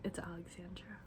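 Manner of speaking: breathy whisper, no voice at all. Real, not acted. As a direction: whisper your name like a secret. Soft whispered channel intro.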